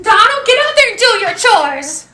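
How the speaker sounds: ordinary delivery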